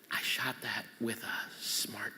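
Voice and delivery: hushed, unvoiced whisper; whispered confession. Real, not acted. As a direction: confess it to the audience under your breath.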